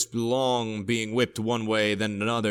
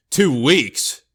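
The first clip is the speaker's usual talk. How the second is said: loud, higher incredulous shout; 4 semitones higher, 7 dB louder than usual. A narrator's reading. Crew hearing they must stay two weeks, shouted. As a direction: shout it back in disbelief. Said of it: "shouted the crew".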